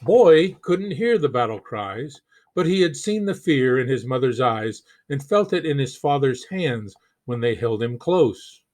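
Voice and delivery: plain reading voice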